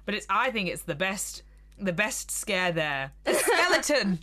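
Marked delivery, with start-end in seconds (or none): 3.3-4.2 laughing exclaim. Real, not acted. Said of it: "excited laughing exclaim".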